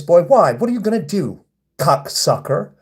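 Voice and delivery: normal talking voice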